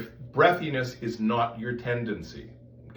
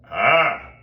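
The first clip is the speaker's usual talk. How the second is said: loud, buzzing "ah"; dentist's drill zing; 9 dB louder than usual. Shown as a demonstration. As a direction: make the ah cut like a drill. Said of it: "sound like a dentist's drill".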